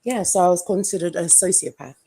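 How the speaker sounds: ordinary talk, nothing marked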